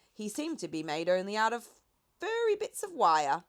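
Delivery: ordinary delivery, nothing marked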